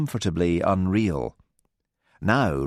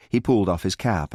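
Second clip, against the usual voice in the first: ordinary delivery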